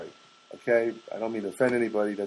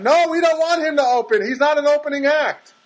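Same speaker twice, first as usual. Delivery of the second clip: high, loud indignant protest; mock outraged hospital spokesperson; hospital arguing; 7 dB louder than usual. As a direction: argue loudly in a raised, high-pitched voice. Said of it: "That's the hospital talking".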